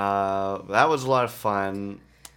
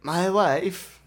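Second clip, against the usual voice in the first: Borat impression; 8 semitones higher than usual. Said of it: "he goes".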